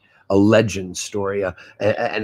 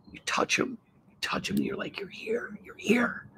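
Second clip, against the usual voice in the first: whisper; 12 semitones higher, 12 dB quieter than usual. Real, not acted. Hushed emotional aside.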